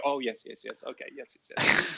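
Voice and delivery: hushed mock agreement. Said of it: "And then they go".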